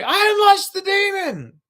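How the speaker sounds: high, loud gloating cry; mock triumphant voice. Imagining the guy gloating as he walks off, loud and high. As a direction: crow like a proud villain. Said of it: "just like".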